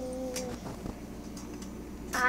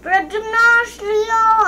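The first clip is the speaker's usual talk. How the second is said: high, loud, stretched name call; 6 semitones higher, 11 dB louder than usual. Retold or imitated. Child showing mother how teacher summoned them, calling loudly. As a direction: imitate a teacher shouting names over a loudspeaker. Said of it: "ಸುಮ್ನೆ ಕೂಗುದ್ರಾ?".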